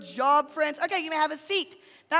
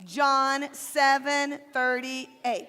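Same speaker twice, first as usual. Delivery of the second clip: slow recitation; 2 semitones lower, much slower than usual. Shown as a demonstration. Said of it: "We'll hold up our Bibles".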